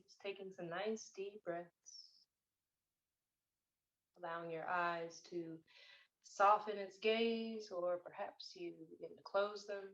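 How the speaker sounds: slow, soft meditation voice with long pauses; guided meditation. Real, not acted. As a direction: gently guide them into stillness, unhurried, phrase by phrase. Leads a meditation.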